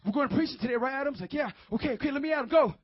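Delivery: loud, fired-up mock zeal; over-radical preacher. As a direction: act out an overeager street preacher. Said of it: "we can be so radical".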